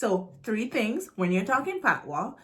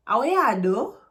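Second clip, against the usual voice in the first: louder, dramatic patois line; dramatic Jamaican patois; 3 semitones higher, 6 dB louder than usual. Shown as a demonstration. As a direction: throw attitude into the patois. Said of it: "See the face? ... dramatization".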